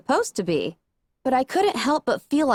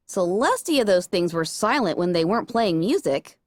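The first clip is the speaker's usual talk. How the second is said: usual speaking voice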